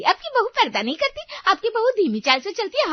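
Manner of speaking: high, loud mocking imitation of a nosy neighbour's taunts; mocking Kanta Devi. Wife mimics judging neighbour to husband, high loud mockery. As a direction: parrot the neighbour's nagging with scorn. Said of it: "वो कांता देवी पूरा दिन मुझे जज करती रही".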